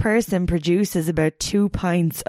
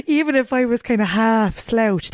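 usual speaking voice

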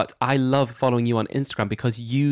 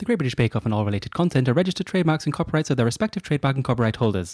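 rattled off fast without a breath; all in one breath; faster than usual. Real, not acted. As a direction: race through it in a single breath. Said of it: "In one breath".

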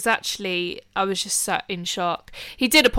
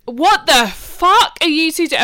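higher, louder, slow disbelieving yell; retold outraged shout; 7 semitones higher, 7 dB louder, slower than usual. Sister yelling at brother over spilled smoothie, outraged. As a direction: yell it at them slowly in disbelief. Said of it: "And I'm like".

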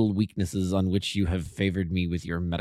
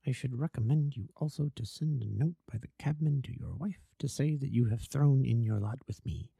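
much softer, kept low and secretive; hushed voice; 7 dB quieter than usual. Acted. Holmes quietly instructs Watson in the opium den, hushed. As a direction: murmur so the den cannot overhear. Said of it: "As low as you can".

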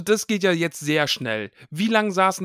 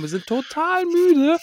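much higher, slow, loud tired whine; mock tired whine; 4 dB louder, slower than usual. Boys sneaking off to bed, hammed-up tired whine. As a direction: whine it out like a faked yawn. Voicing Harry and Ron faking tiredness.

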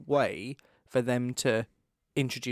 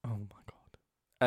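whisper; 2 semitones lower, 5 dB quieter, much slower than usual. Real, not acted. Whispered reaction.

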